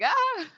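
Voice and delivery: short high-pitched "ah"; mock startled yelp. Retelling Toru caught by Kyo, startled mock yelp.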